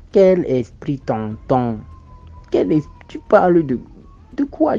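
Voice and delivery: indignant shout. Retold or imitated. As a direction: shout it in disbelief at the caller. Retelling his own protest to the marabout. (cri indigné, fort et aigu)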